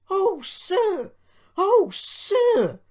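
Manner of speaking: very high, loud squeal of delight; shrieking with excitement; ecstatic. A narrator's reading. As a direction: bubble over with joy at full pitch. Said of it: "bubbled Peter Sam in ecstasy".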